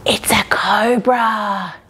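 slow, hushed mock-scary whisper; whispered reveal. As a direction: whisper it like a spooky secret to kids. Storytelling the cobra reveal.

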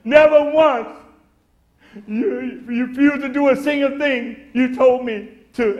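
put-on loud, pleading older brother; crying out aggrieved. Resentful son shouting at his father, loud and pleading. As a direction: hammer the complaint at his dad, loud. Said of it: "Can you hear him crying out?".

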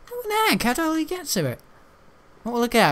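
squeaky high mock gamer; mock eager player. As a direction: imitate a greedy kid spotting loot. Voicing another player's eager thoughts.

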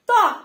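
ত্বক with a firm, loud initial t; slightly forceful pronunciation. Shown as a demonstration. Teacher shows how tay-ba is pronounced, slightly forceful. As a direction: punch the start of ত্বক, ব silent. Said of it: "একটু জোরালো ভাবে".

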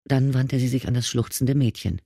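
ordinary delivery

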